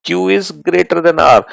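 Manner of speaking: very slow, word by word, spelling it out; slow model answer. Shown as a demonstration. Teacher slowly models the answer for the student, word by word. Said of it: "हम इसको क्या बोलेंगे?".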